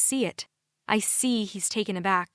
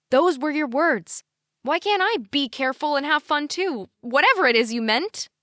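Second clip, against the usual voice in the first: high, heated, insistent protest; voice rising; 6 semitones higher, 4 dB louder than usual. A narrator's reading. Daughter challenging parents' double standard, voice rising. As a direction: argue it out with raised pitch. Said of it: "My voice rises".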